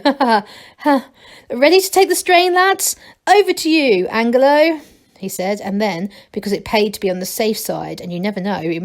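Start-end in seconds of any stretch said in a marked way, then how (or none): 0.0-1.1 mild mock laugh; a short awkward ha ha ha; forced laugh. A narrator's reading. Masklin joking with Angelo, mild forced laugh. 1.5-4.8 higher, louder call to the lads; rally the rope team. A narrator's reading. Masklin calling the rope team to take the strain.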